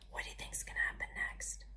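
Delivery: hushed suspense whisper; storyteller whispering to the children; whispered question. A narrator's reading. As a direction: lean in and whisper.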